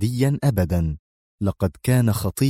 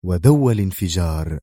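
usual speaking voice

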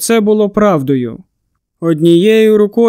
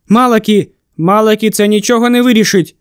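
ordinary delivery

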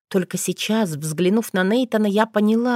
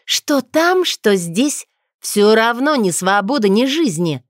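much higher, louder outcry; bitter shout; 4 semitones higher, 5 dB louder than usual. Acted. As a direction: fling it at him. Witch shouting in quarrel.

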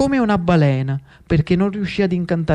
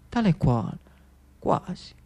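whisper; 9 dB quieter than usual. A narrator's reading. Twin brothers, nearly identical.

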